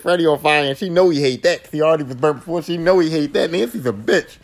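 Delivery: normal talking voice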